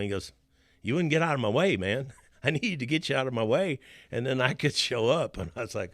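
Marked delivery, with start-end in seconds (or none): 0.8-5.4 higher and louder, chuckling through the words; laughing, teasing God. Retold or imitated. God ribbing the speaker for being in the way, laughing loud. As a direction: tease him with a laugh, like a friend ribbing you.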